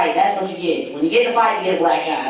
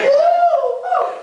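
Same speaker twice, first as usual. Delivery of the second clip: excited whoop. Real, not acted. Crowd answers amen.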